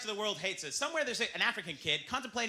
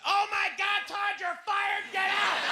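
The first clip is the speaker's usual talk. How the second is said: angry boss; 13 semitones higher, 3 dB louder, slower than usual. Acted. Boss fires employee in sketch.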